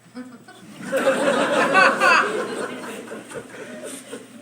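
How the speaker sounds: giggling laugh. Acted.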